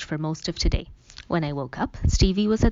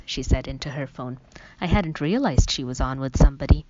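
usual speaking voice

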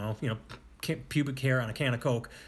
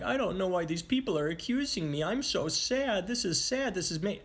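mocking whiny Kavanaugh; 6 semitones higher than usual. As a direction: whimper it like a pouting accused man. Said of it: "and he's like".

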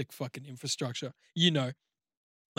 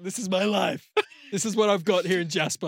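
mock despair; 5 semitones higher, 4 dB louder than usual. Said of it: "just, like, the desperation".

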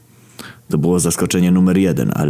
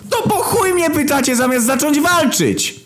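exasperated villainess. A narrator's reading. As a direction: snap in a high impatient voice. Villainess loses patience.

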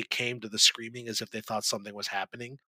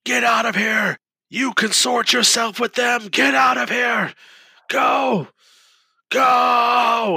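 high, loud, drawn-out screaming; 12 semitones higher, 9 dB louder, slower than usual. Acted. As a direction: scream them out of the room. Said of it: "He just keeps screaming".

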